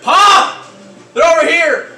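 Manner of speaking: high, full-force yell; urgent shout. Acted. Character warns Pop they are over here, urgent shout. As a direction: call out loudly to warn them. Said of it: "you hear him say".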